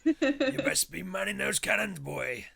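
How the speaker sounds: gruff slow pirate captain, loud. Pretend. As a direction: bark orders like a pirate captain. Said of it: "pirate voice".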